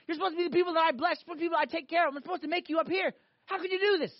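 high-pitched, impassioned scolding; angry, loving God. Acted. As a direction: pour out an indignant reproach. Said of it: "when God gets angry".

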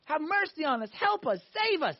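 high-pitched cry for rescue, volume held back; mock screaming plea. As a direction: imitate desperate men calling for help.

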